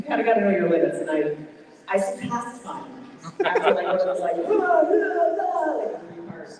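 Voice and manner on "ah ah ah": high, loud mock battle cries. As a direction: throw out repeated effortful fight yells. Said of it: "doing that multiple times".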